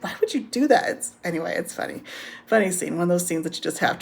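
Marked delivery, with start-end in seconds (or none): 0.0-0.9 high-pitched retold disbelief; mock bafflement. Rokia questions letting children win at play, mock baffled. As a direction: imitate a puzzled question, pitched well up.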